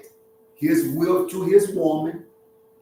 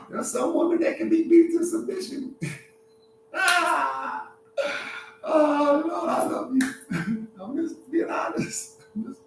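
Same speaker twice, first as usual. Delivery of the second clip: high, laughing through the words; helpless high giggle between phrases; 10 semitones higher, 4 dB quieter than usual. Real, not acted. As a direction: crack up while trying to keep talking. Cracking up at own remark.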